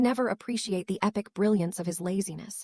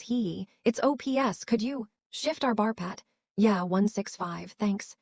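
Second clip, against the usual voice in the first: ordinary delivery, nothing marked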